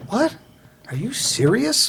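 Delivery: drawn-out disbelief; retold amazement.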